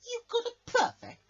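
high, loud astonished shriek; shocked squeal. Acted. Crash gasps at Headband's perfect, shocked squeal. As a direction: squeal it out in disbelief.

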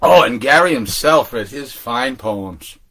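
usual speaking voice

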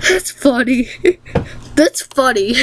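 amused, breaking into giggles; tickled, giggling between the words; real laughing. Real, not acted. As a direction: say it through laughter. Cracking up at the picture.

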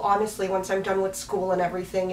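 ordinary talk

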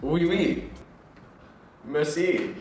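mock French replies; lightly faked French accent; put-on French. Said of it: "Have you been French before?".

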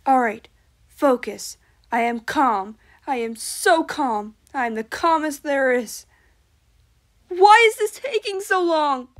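forced calm snapping into a shout; calm turning angry. Acted. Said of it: "[name], medium voice".

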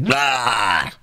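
sudden harsh scary "ah"; imitated monstrous lunge cry; mock scream. Old hobbit glimpsing the ring, a sudden mock scream.

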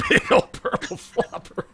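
high, slow, laughing through it. Real, not acted. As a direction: crack up while saying it. Laughing repeat of joke.

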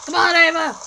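loud rallying call to Ava; 1 cheering shout. Real, not acted. Player cheering on Ava, loud shout. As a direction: cheer her on at full voice.

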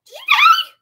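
high shout. Real, not acted. Shouting to teammate mid-fight.

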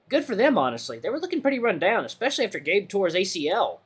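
chatty badger. Badger piping up about absent friends, lightly voiced and chatty. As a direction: pipe up with casual approval.

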